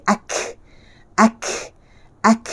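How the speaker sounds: "ak" fully released with air; airy kaf. Shown as a demonstration. Teacher shows kaf's full release, airy "ak". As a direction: let wind follow the k in "ak".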